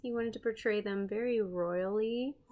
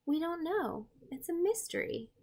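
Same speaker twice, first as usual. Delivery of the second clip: higher, hushed sense of mystery; wondering; 8 semitones higher than usual. Acted. Telling young viewers the cave artist is a mystery, higher and hushed. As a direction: make it sound like a puzzle.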